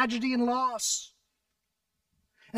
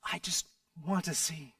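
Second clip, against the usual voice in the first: low, soft, pleading; pleading softly; 5 semitones lower, 8 dB quieter than usual. A narrator's reading. Blind man pleading with the Teacher, soft and low. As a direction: plead quietly from the heart. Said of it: "The blind man said".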